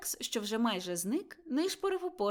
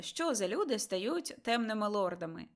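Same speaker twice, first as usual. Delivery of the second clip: ordinary delivery, nothing marked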